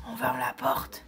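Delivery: soft unvoiced lullaby whisper; whispering over a crib. Real, not acted.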